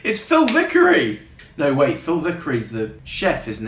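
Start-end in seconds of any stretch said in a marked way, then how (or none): 0.0-1.2 amused realisation. Retold or imitated. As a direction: exclaim it as if it just clicked, laughing. Sudden recognition of a familiar voice.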